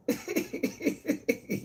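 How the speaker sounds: airy wheezing laugh, high up; high breathless laugh. Real, not acted. Vlogger laughing off a rough day online, airy wheeze.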